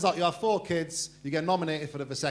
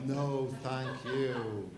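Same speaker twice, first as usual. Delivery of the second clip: very slow, lower repeated dismissal; drawn-out refusal; 4 semitones lower, 4 dB quieter, much slower than usual. Acted. Comedian repeats his dismissal of the receptionist, slow and lower. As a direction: stretch it out, letting it sink.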